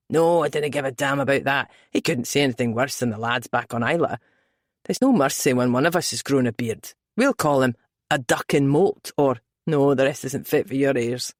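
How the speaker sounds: low Scottish brogue, male lead; deep, easy Scottish man. A narrator's reading.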